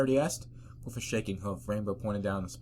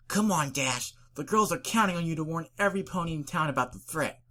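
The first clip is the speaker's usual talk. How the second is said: self-urging Rainbow Dash; 8 semitones higher, 3 dB louder than usual. Acted.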